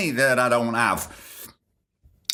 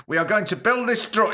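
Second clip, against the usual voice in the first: a hint of an Indian accent, pitched higher, cut off; started Indian accent; 7 semitones higher than usual. Reading the professor's quote, briefly in a mock Indian accent. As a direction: begin the quote in a light mock Indian accent then stop. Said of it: "I'm not gonna do an Indian accent".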